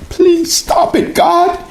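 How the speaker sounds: retold plea, very high and slow; crying plea. Dreamer begging God to stop teasing her, crying plea. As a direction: beg in a high, tearful-sounding wail. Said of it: "I would start crying".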